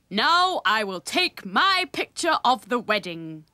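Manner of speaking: loud, slow, deliberate announcement; big, unhurried character voice; uncle's voice. A narrator's reading. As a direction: announce it grandly, stretching the words. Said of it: "said Uncle Fred".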